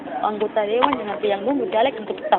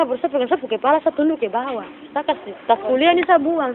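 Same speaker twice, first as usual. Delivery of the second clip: usual speaking voice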